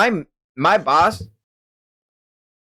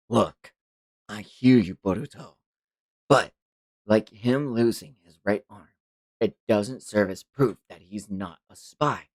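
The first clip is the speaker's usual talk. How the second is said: lower, soft, reasoning voice; Shikamaru, measured; 4 semitones lower, 5 dB quieter than usual. Acted. Older man reasons with Boruto, low and measured. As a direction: reason patiently, voice held down.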